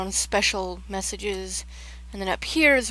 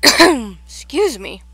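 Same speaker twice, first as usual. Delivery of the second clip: slow, much louder excuse me; 4 semitones higher, 14 dB louder, much slower than usual. Real, not acted. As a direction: say it loud and drawn out.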